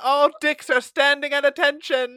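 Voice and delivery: high, loud proclamation; town crier. Retold or imitated. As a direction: announce it to the whole square.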